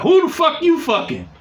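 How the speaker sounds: jealous angry demand. Retold or imitated. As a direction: hurl the accusation down the phone. Ex calls demanding, acted quarrel.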